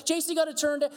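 put-on high-pitched kid bargaining with Dad; pleading child. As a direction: imitate children stalling with excuse after excuse. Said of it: "Kids always have this reason".

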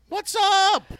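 high, loud hyped greeting; excited greeting. Acted. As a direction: greet her like a radio host. Greeting guest hype.

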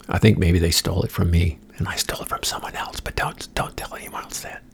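secretive unvoiced whisper. Confiding a stolen joke to listeners, whispered. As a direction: whisper the confession.

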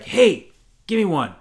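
sudden loud high hey to get attention; loud call. Retold or imitated. Guy on plane calling over to get a snack. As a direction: call out to the seatmate.

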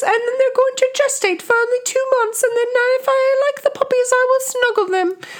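put-on high, prim old royal voice; Queen Victoria. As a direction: play a fussy elderly queen. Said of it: "that real audio clip of Queen Victoria".

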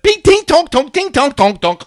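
high, loud metallic pinging imitation; punchy imitated ricochets; ricochet sounds. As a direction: rattle off cartoonish clanging bounces. Imitates rocket ricocheting inside helicopter.

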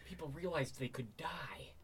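unvoiced dramatic whisper; spooky whisper. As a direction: breathe it like a secret.

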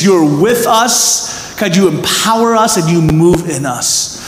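earnest, steady closing prayer. Acted. Pastor leading the church in prayer, earnest and steady.

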